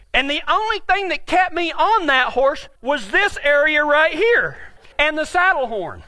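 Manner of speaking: ordinary talk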